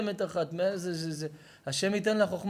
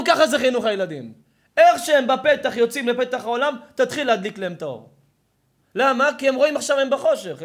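loud, high-pitched sermon delivery; fiery preaching; 7 semitones higher, 11 dB louder than usual. Acted. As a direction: preach it hard to the crowd. Preaching at full force.

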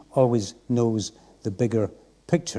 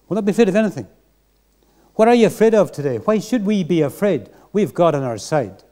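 rousing challenge; 6 semitones higher, 4 dB louder than usual. Real, not acted. Preacher rising to exhortation.

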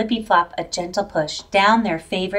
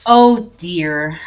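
a mild let-down sigh; sighing oh dear; 3 semitones lower, 5 dB louder than usual. A narrator's reading. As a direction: let the disappointment out gently. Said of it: "Flippy Flop sighed".

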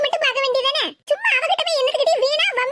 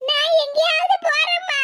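slow, high-pitched theatrical bawling; hammed-up crying fit; crocodile tears; 5 semitones higher, slower than usual. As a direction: wail it out, dragging each phrase into a sob. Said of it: "நீலி கண்ணீர் வடிக்க".

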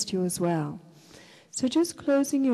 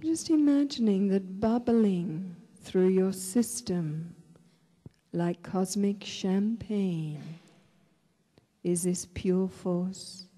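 guided meditation; 4 dB quieter than usual. Real, not acted. Leads a breathing meditation.

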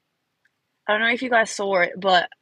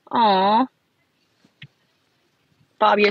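usual speaking voice

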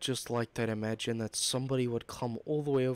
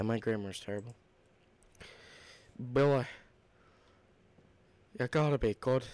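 ordinary delivery

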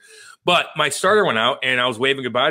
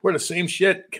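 ordinary talk, nothing marked

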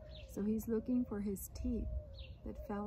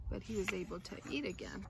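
ordinary delivery, nothing marked

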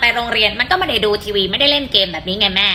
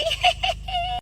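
high burst of laughter; 7 dB quieter than usual. Real, not acted. Laughs at silly tip.

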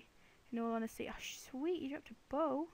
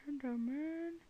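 higher, put-on drawn-out ENDERMAN; playful drawn-out call; 3 semitones higher, slower than usual. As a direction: draw out ENDERMAN, rising in pitch. Repeats the name in put-on voice.

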